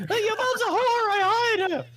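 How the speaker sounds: high-pitched gleeful jeer, half laughing; mock taunting. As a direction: crow it high like a cheeky brag. Acts out a taunt in a story.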